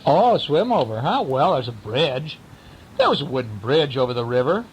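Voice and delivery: usual speaking voice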